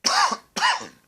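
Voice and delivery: real harsh cough; sudden hacking cough; loud cough. Real, not acted. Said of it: "I'm coughing a lot".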